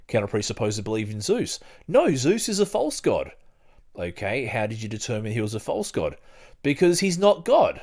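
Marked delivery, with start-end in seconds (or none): 1.9-3.3 imitating a believer, higher and louder; mock believer. Believer insisting to the host, a mock indignant higher voice. Voicing imagined presuppositionalist opponent. 6.6-7.8 imitating a believer, higher and louder; mock believer. Believer insisting to the host, a mock indignant higher voice. Voicing imagined presuppositionalist opponent.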